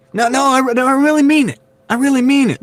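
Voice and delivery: very high, insistent politician voice; Joe Biden impression. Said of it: "That's my Joe Biden".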